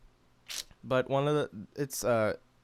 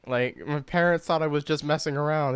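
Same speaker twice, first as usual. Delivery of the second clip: ordinary talk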